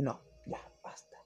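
whispered, giving up; fed-up whisper. Retold or imitated. Reviewer quits the Italian audio, whispering in defeat. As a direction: breathe it out like you've had enough. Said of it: "y dije".

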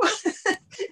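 a high laugh that half-cries; high crying laugh; high teary laugh. Real, not acted. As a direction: laugh it off with a wet, high giggle. Laughing after retold crying.

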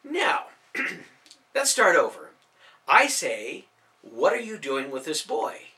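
mock-polite menace with a throat clear; semi-threatening. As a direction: calmly lean on him, bow drawn. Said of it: "in a semi-threatening fashion".

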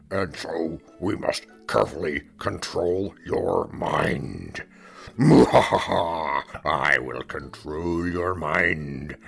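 slow, loud villain threat; slow theatrical villain menace. Acted. Mock mind control threat.

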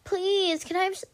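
put-on high, pleading little kid; greedy kid voice. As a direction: beg like a greedy child. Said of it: "don't I sound like such a greedy kid".

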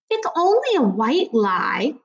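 slow, mildly protesting mom. A narrator's reading. Miss Morales defends herself to Natalie, slow mild protest. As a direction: stretch the words out in mild protest. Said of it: "Miss Morales protested".

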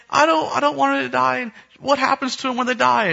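high-pitched imitation of an upset girl; hammed-up tearful child voice; mock crying child. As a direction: wail it like a distraught little kid. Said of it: "she starts crying uncontrollably".

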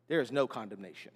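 low, soft, settled statement; quiet assurance. Real, not acted. Preacher assuring believers of no condemnation, quietly and low. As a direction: drop right down to deliver the assurance. Same line softly, then emphatically.

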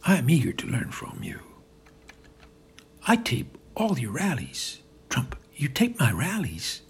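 ordinary delivery

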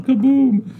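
high, loud playful kaboom; mock explosion. Host jokes about a glitter bomb going off, mock explosion. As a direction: boom it out like a cartoon blast.

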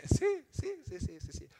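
giggling yeses; amused, laughing agreement. Real, not acted. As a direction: laugh through the words. Laughing at her own words.

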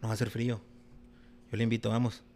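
soft, low persuading voice; coaxing. Retold or imitated. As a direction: gently talk her into coming. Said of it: "voicing himself coaxing". (persuadiendo en voz baja)

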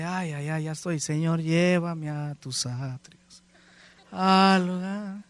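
dazed, drawn-out plea to heaven; slow, stretched-out words, as if drifting away; woozy, floating. Retold or imitated. Preacher retelling how the pill left him floating, woozy drawl. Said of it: "me sentía como que estaba flotando".

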